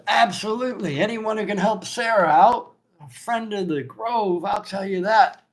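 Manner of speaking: high, loud, booming innkeeper; hearty barkeep. Acted. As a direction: praise the heroes like a jolly host. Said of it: "The barkeep goes".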